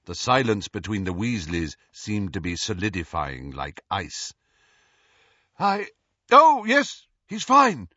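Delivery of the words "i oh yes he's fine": flustered, high, loud reply; startled, pitched-up answer. A narrator's reading. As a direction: cover the awkwardness too loudly. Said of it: "Lupin said loudly".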